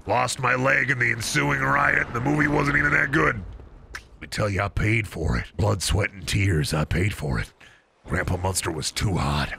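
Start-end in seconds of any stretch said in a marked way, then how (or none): 3.9-9.5 put-on deep haunted old soldier; low grizzled veteran. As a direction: recall it like a scarred survivor. Low dramatic grizzled recollection.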